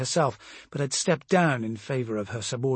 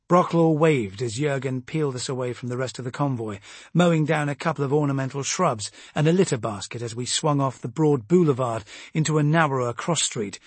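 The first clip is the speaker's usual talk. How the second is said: ordinary delivery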